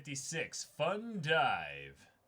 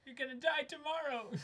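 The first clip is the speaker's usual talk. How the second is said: mock doom; 12 semitones higher than usual. Teasing friend scared of flying.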